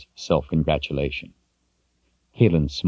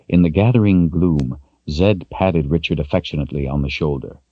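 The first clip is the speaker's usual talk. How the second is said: ordinary delivery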